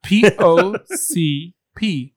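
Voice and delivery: P O C P spelled out slowly, letter by letter. Shown as a demonstration. Announcing the podcast topic, slow spelled letters. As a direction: lay each letter down with weight. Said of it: "spelling the acronym slowly".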